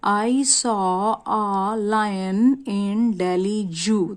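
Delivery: very slow example sentence; slow classroom reading of an example. Shown as a demonstration. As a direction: read the example aloud slowly, word by word. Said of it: "example के लिए यहाँ देखिए".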